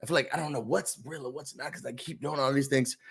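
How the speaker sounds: quietly imitating a lost guy; mopey mock houseguest. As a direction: mumble it like a confused bro.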